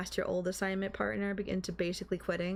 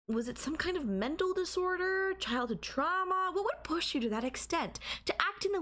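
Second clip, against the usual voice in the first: eager curiosity; 9 semitones higher than usual. Acted. Fascinated by his obsession.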